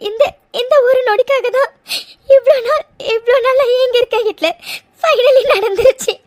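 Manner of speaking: overwhelmed, weepy relief; tearful joy. Acted. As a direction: voice full of happy tears. Weeping with joy to husband.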